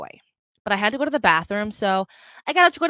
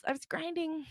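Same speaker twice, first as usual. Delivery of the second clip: high, very soft aside; hushed, high-pitched echo; understated alternate take; 7 semitones higher, 12 dB quieter than usual. Acted. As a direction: say it small and high. Same line as the other version.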